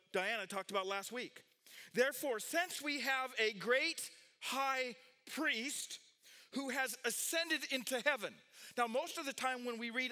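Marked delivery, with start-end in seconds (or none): 1.9-8.3 high, stretched, declamatory verse reading; proclaimed scripture reading. A narrator's reading. Preacher reading the verse to the congregation, proclaimed scripture reading. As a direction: announce the verse with weight.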